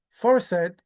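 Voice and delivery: ordinary talk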